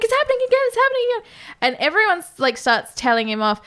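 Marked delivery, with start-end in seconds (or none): 0.0-1.3 high-pitched imitated panic; mock freak-out. Retelling Samsy losing it, high mock panic. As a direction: panic in a much higher voice, repeating it. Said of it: "he starts freaking out".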